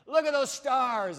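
high, loud, drawn-out marvel; excited urging. Acted. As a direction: urge someone to look up, loud and slow. God shows Abraham the sky, acted.

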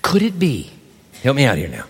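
usual speaking voice

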